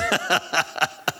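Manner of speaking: soft, voiceless chuckle at the punchline; breathy laugh. Real, not acted. Pastor laughing at the husband's payback, soft and breathless. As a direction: laugh quietly at the story.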